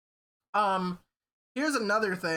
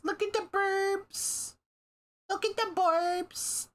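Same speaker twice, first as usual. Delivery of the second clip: silly high voice; 12 semitones higher, slower than usual. Acted. Repeating a silly phrase.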